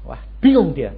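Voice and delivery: usual speaking voice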